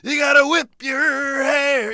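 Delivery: high, slow, stretched-out shouted chant; shouted high rock-star imitation, each word stretched; Bruce jumping in with energy. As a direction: belt it out high and drawn out like a rocker crashing the song. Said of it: "he's gotta jump in with that energy".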